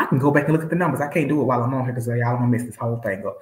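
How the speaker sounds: normal talking voice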